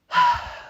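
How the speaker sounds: high exasperated sigh; weary sigh. Acted. Aunt sighing before the Viking question comes, weary.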